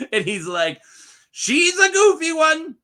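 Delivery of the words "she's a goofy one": high, loud mock king, drawn out at the end; imitated goofy king. King brushing off his queen to find his son, playful high imitation. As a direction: call out like a hammed-up jolly king. Acting out Aegon dismissing the queen.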